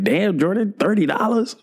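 high-pitched mock disbelief, rising into a question; incredulous reaction. Friends react to his bill.

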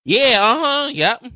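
mocking singsong "yeah, uh-huh"; overdone sarcastic agreeing; sarcastic agreement.

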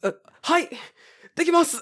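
very high, timid greeting; high-pitched comic deference; meek mock obedience. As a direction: squeak out a submissive reply. Meek reply to the shouting man.

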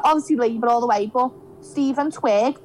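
ordinary delivery

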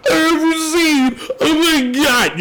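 high, teary mock fan gush. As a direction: gush like an overwhelmed fan, high and near tears. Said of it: "and you're like".

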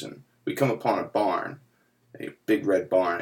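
normal talking voice

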